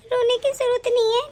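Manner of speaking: normal talking voice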